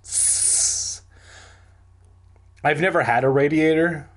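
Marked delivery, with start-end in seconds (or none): 0.0-1.0 long soft hiss of escaping steam; drawn-out "sss" like a valve. A narrator's reading. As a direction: imitate steam hissing out. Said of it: "I heard the loud hiss".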